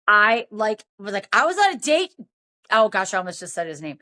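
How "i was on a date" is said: higher-pitched indignant retelling; mock outrage, pitch jumping up; going off. Woman telling off the pirate on the phone, indignant. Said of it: "I went off on him".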